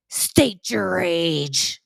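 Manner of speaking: slow, flat command, lightly voiced stranger; gym guy's demand. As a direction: drag out each word like a stern stranger. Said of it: "it sounded just like that".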